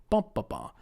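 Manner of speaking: "Ba, ba, ba" with first beat strong; BA-ba-ba, three beats; first-syllable stress pattern. Shown as a demonstration. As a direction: stress the first "Ba", let the rest fall. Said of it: "stress that first syllable".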